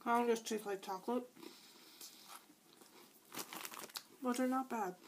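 speaking between bites; talking while chewing. Real, not acted. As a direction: mouth partly full of cookie. Seen 0: Holds cookie, bites at 1.7s, cheek bulges chewing while talking.

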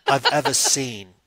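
slow, loud, scathing British judge; hammed-up withering talent judge; Simon Cowell. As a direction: deliver a drawn-out British put-down with big pauses. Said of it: "the Simon Cowell".

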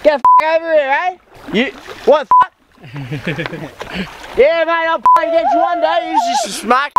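mock Australian. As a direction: ham up an Australian accent, shouting oi mate. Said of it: "maybe it's Australian".

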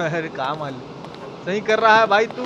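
ordinary talk, nothing marked

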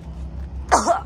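coughing from a stench; retching cough; gagging cough. Real, not acted. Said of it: "I might actually vomit".

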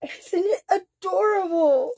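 high, slow, near-crying coo; overwhelmed by cuteness. Real, not acted. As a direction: whimper with adoration. Cooing over bat duck.

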